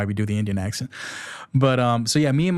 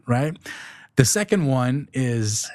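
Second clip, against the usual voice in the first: ordinary talk, nothing marked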